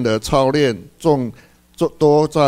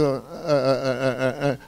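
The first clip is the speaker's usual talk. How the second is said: mock hesitation; 7 dB quieter than usual. Imitating brother groping for words.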